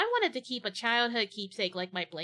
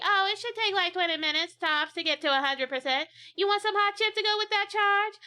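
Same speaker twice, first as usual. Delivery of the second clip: high, bright sales pitch, louder; high saleslady voice; 9 semitones higher, 4 dB louder than usual. Acted. Charger lady upsells hot chip, high perky voice.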